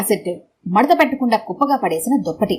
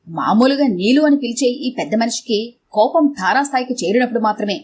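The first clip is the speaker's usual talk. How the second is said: usual speaking voice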